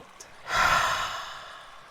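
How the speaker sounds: long breathy exhale, tailing away; demonstrative sigh out. Shown as a demonstration. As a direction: let a slow sigh release the breath. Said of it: "sighing out".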